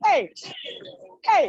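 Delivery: loud cheer; hype shout. Real, not acted. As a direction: shout to pump up the class. Fitness coach hype call.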